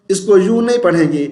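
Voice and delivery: ordinary delivery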